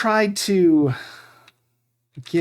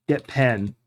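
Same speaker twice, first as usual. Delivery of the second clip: ordinary talk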